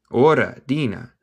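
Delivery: normal talking voice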